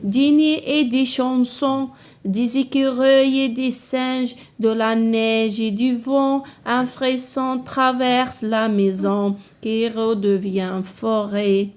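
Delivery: slow, measured poem reading with sustained, level notes; poem recitation. Shown as a demonstration. Teacher reads the poem to pupils, slow measured recitation. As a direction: recite line by line, drawing out the words.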